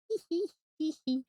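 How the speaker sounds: high giddy "hee hee"; mock giggle. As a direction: titter like a giddy couple, put on. Voices the giddy couple.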